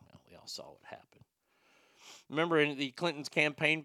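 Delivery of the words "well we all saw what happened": a dry whisper, knowing; hushed told-you-so. Real, not acted. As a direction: whisper it like an open secret. Knowing aside after the story.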